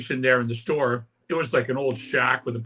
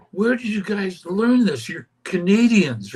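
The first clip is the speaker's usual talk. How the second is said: slow, high, drawn-out local asking questions; imitating locals who can't believe Canadians play punk; puzzled American locals; 7 semitones higher, 4 dB louder, slower than usual. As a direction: play the baffled small-town guy quizzing the Canadians. Locals quizzing the band, acted quote.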